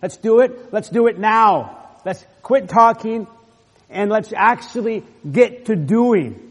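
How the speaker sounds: leader's charge. Acted. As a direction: command them to act now.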